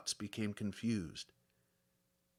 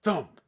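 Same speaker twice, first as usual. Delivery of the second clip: sharp loud thump then bang; loud imitated banging; loud banging noises; 4 semitones higher, 6 dB louder than usual. A narrator's reading. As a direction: bark out the knocks like blows on a door. Banging again outside.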